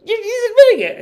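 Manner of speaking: very high, loud squeal; imitating a child in hysterics; kid losing her mind. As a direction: shriek it like a giddy small child. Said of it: "she's, like, losing her mind".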